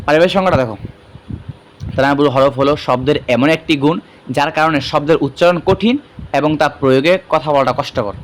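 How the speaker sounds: ordinary delivery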